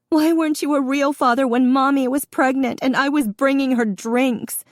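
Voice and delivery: very high, loud crying accusation; sobbing shout. Acted. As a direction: wail it through the sobs. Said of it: "The sobs were out now".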